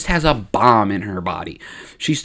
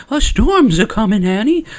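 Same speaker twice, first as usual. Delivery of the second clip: high, put-on old woman voice; old lady; 9 semitones higher, 4 dB louder than usual. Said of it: "old lady Jira's shop".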